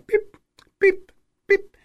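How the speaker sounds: short high monitor beeps; thin electronic-style beeps, spaced apart; imitated hospital beep. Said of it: "you hear some hospital sounds".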